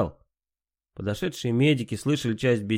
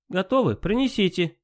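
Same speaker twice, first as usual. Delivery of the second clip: imperious plummy grande dame; 9 semitones higher than usual. Acted. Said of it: "надменно произнесла Малиновская".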